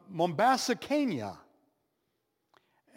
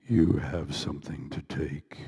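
voice of God. Retold or imitated. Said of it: "the Spirit of God said to me".

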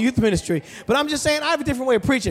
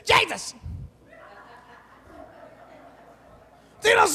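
high ringing shout of the name; shouted Jesus; 10 semitones higher than usual. Retold or imitated. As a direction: cry out the name like the preacher. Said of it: "every once in a while I hear this".

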